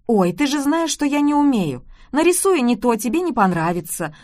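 lightly voiced mom waving it away; mom's voice. A narrator's reading.

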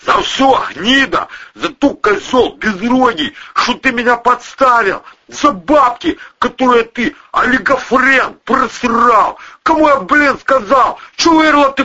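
high, loud stream of abuse; furious tirade. A narrator's reading. Boss berating the pal who let him down, furious. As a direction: rage on, hurling each accusation.

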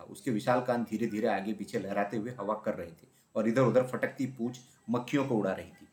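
ordinary delivery, nothing marked

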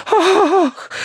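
shocked ladylike cry; high gasping "ah". A narrator's reading. Hostess is drenched by water, gasping cry. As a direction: gasp as if drenched. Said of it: "заахала несчастная жертва".

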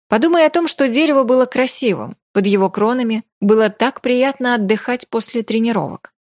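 ordinary delivery, nothing marked

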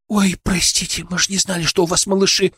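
high-pitched fussing woman; high, fretful lament; lamenting apology. A narrator's reading. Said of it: "запричитала Лукерья".

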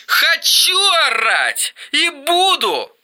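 a brother roaring on the phone; shouting. Acted. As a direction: scream it defiantly. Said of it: "заорал Эрик".

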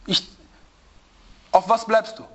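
normal talking voice

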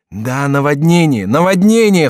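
high, loud, climbing cry. A narrator's reading. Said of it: "вскричал Генрих".

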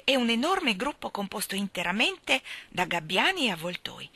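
ordinary delivery, nothing marked

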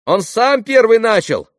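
put-on high, loud, annoyed little boy; irritated child's voice. A narrator's reading. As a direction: shout back like a cross child. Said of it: "раздражённым детским голоском". (раздражённый детский голосок)

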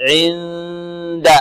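very slow عند, tongue readying for د through the hum; ikhfa before د. Shown as a demonstration. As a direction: stretch the hidden ن of عند as a nasal hum, then release da. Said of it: "نتهيأ إلى، لمخرج الدال". (عند بإخفاء النون مع الغنة)

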